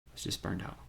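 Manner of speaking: breathy near-whisper, voice dropping right away; exhausted hushed admission; hushed confession. Real, not acted. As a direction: let it slip out under your breath. Recalling near-suicide burnout.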